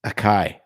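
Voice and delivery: Acai said with a hard k, "uh-KAI"; alternative pronunciation. Shown as a demonstration. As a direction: say Acai as "a-kai", two syllables. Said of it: "That's what it should be".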